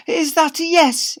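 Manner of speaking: high, slow hopeful ask; Mister Lovey hopeful; hopeful question. Acted. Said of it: "inquired the English teacher".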